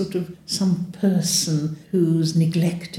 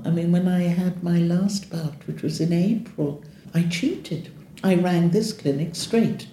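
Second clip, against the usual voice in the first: usual speaking voice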